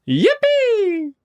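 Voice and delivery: long, very high whooped yippee; Grandpa Joe's cheer. Acted. Quoting Grandpa Joe's last line, a high whoop. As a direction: let out a high, drawn-out whoop. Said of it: "Grandpa Joe saying".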